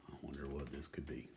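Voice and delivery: ordinary delivery, nothing marked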